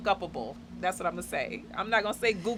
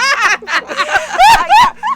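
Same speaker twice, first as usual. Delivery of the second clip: high burst of laughter; loud laugh; 17 dB louder than usual. Real, not acted. Laughing at the welcome bit.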